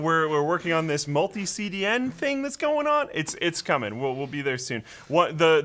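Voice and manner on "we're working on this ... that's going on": ordinary talk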